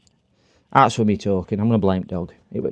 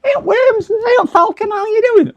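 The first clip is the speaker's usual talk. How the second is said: very high, louder cartoon squeak; squeaky mock voice; 5 dB louder than usual. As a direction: greet in a silly shrill cartoon voice. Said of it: "That's how I think they talk to each other".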